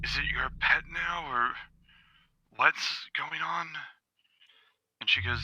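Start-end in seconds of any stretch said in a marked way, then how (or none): 0.0-3.7 lightly voiced Vulcan. Vulcan asks Kyra about the dog, softly questioning. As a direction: lightly play a father's quiet query. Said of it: "Kyra and he goes".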